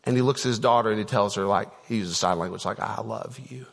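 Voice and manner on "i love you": almost breathless, very soft and tender; tender hushed I love you. Retold or imitated. Pastor voicing the dad's last message to his daughter, hushed and tender. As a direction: say it like a father's silent goodbye.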